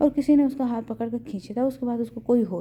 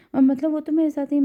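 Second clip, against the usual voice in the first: sudden alarmed burst, higher and louder; 5 semitones higher, 7 dB louder than usual. Acted. Husband on phone hears wife is missing, alarmed. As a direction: jolt up in alarm at the news.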